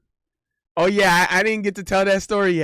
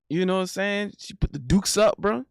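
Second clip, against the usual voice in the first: ordinary talk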